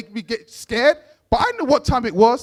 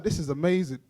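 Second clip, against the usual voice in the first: awed aside; 6 semitones lower, 8 dB quieter than usual. Retold or imitated. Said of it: "I was like".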